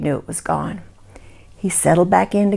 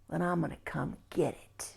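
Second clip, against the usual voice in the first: soft, breathy creature threat; menacing whisper; 4 semitones lower, 18 dB quieter than usual. Acted. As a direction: whisper the threat right in the dark. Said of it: "And the voice said".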